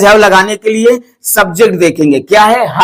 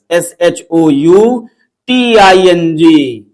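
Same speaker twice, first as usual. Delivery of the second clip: slow letter-by-letter dictation; each letter drawn out separately; spelling out; 2 semitones lower, much slower than usual. Shown as a demonstration. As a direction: spell it at half speed for students to copy. Spelling out shouting for class.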